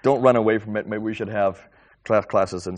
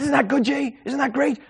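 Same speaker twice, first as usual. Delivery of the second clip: thrilled friend; 6 dB louder than usual. Retold or imitated. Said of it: "He said".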